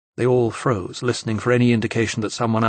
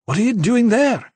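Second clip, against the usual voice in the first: rising alarm; 11 semitones higher, 4 dB louder than usual. A narrator's reading. Enzo alarmed.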